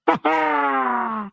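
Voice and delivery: high shouted cackle of a laugh. Real, not acted. Mocking laugh after taunt.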